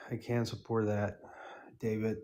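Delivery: ordinary delivery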